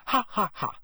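flat mock "ha ha ha"; sarcastic fake laugh. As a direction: sneer out a dry fake laugh. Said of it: "said Hermione sarcastically".

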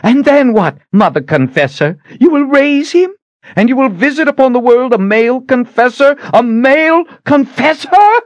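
very high, loud enraged scream; shrill-pitched furious tirade. A narrator's reading. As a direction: scream each accusing question. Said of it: "Shota was screaming at her".

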